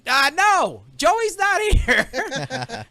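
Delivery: high, slow, fake innocent reply; scared kid voice. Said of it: "He goes".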